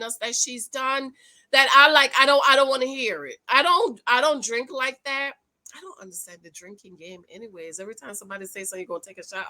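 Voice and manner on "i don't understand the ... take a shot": muttered aside. Real, not acted.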